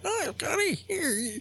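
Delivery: put-on very high, drawn-out old-man voice; crinkly old man. Old man on a front porch greets Scotty, crinkly. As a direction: imitate an old man calling slowly from his porch. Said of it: "like this crinkly old man sitting".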